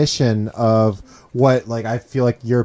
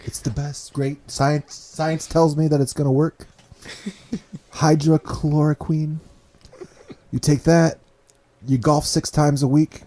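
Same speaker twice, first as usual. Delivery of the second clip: higher-pitched boastful politician impression; Donald Trump; 5 semitones higher than usual. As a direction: sell it in short, pompous bursts. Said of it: "Do your Donald Trump voice".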